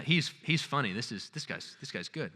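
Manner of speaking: ordinary talk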